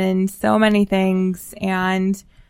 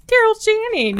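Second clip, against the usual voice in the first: much higher mock-dramatic name call; gushing fan; 13 semitones higher than usual. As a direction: swoon over the name like a theatre fan. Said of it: "but we're being like".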